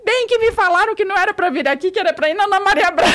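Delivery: high, loud imitation of an upset guest; mock tearful outburst. Guest complaining to the journalist she was warned, mock wailing outburst. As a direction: wail the complaint, hammed up and high. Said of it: "começou a chorar, tal".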